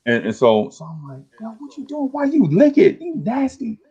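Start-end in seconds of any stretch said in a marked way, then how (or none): 1.4-3.8 high, disgusted teasing; grossed out. Retold or imitated. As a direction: recoil in mock disgust. Friend licks the blunt.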